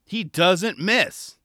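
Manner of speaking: very slow, drawn-out confident call; very slow mock commentator certainty; quoting commentator. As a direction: lay it on slow like a sure commentator. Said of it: "[name] decides to chime in".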